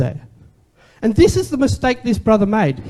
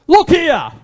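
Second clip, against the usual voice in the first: angry son; 7 semitones higher than usual. Retold or imitated. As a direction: bark "Look here" at the father in resentment. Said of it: "In his anger coming out".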